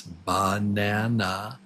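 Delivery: "Banana" slowly, in three even beats; syllable by syllable. Shown as a demonstration. Teacher asks how many beats, "Banana" syllable by syllable. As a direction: measure out ba-na-na in "Banana". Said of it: "We're going to clap the beats".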